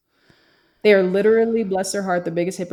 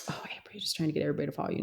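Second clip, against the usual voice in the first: mock skeptic; 3 semitones lower, 12 dB quieter, much faster than usual. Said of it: "I know that sounds like".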